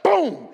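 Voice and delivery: loud shouted boom. Retold or imitated. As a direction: shout it like an impact. Crash sound effect.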